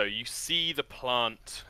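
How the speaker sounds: ordinary talk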